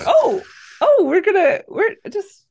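high flustered stammer, mock shocked. Watching the movie, flustered and squeaky. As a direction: squeak it out, caught off guard. Reacting to a sex scene.

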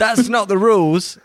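high, loud mock protest; imitated shouting. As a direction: call out a correction, imitating alarmed officials. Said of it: "were shouting at him".

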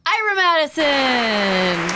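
long drawn-out name announcement; hyped host intro, held long; announcer introduction. Pretend. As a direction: stretch the name out like a show announcer. Redoing the guest's applause introduction.

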